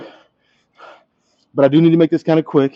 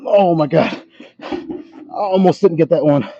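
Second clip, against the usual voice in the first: slow, breathless relief; out of breath; 5 semitones higher, slower than usual. Real, not acted. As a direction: gasp it out, spent. Straining after a hard rep.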